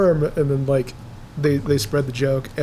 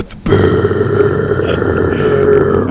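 low, loud death metal growl; 7 dB louder, much slower than usual. Pretend. Joking the name sounds like a metal band, growling it.

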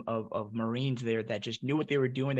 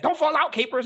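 high, louder yelled order; shouted command; 12 semitones higher, 4 dB louder than usual. Retold or imitated. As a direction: bark it at a lagging recruit. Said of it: "He's like".

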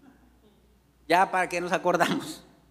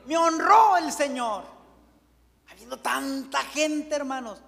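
high, loud, building preacher voice; full-force preaching; 6 semitones higher, 4 dB louder than usual. Acted. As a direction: preach it out to the whole church. (predicación a todo pulmón)

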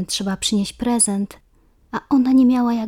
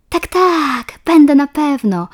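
much higher, louder, bursting yes; eager; 7 semitones higher, 8 dB louder, slower than usual. A narrator's reading. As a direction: leap at the chance. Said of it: "ożywiła się ... Asia".

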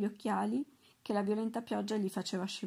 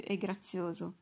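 ordinary delivery, nothing marked

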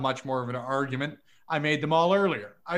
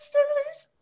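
high awkward voice; 4 dB louder than usual. Pretend. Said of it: "awkward voice for it".

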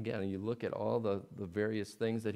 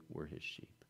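whispered; 7 semitones lower, 13 dB quieter than usual. Acted. Quiet quoted confession.

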